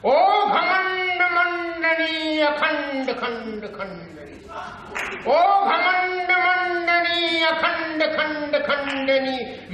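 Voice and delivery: high, slow heroic chant; stretched veer-ras recitation ringing out; Shyam Narayan Pandey. Acted. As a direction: declaim it like a battle ballad. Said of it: "Pandey जी Beerus की भाषा में से देखकर क्या कहते".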